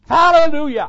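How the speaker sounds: very high, loud praise shout; full-voiced jubilant pulpit cry. Real, not acted. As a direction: shout it out for the whole congregation. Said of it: "If y'all won't shout, I will".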